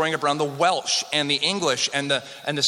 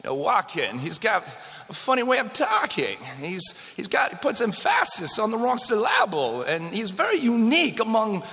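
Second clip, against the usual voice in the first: higher-pitched impression with oddly placed emphasis; Christopher Walken; 7 semitones higher than usual. Lecturer showing the audience a funny way of talking, impression. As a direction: put emphasis on the wrong syllables, halting and high. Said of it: "uh, Christopher Walken".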